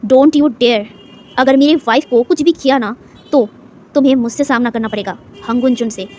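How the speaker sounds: ordinary delivery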